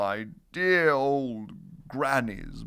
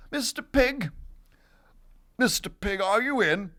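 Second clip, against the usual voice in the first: higher, sing-song call at the door; 6 semitones higher than usual. Acted. Wolf calls on the brick house pig, calling out. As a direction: call in to see who is home. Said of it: "I called".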